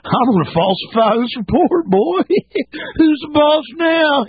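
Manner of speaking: high villainous voice with cackles; hammed-up corrupt cop, gloating and giggling; mock gloating bully. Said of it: "when you talk about somebody saying".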